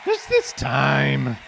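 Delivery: Trump impression. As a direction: stretch it out like Trump. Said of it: "your Trump impression".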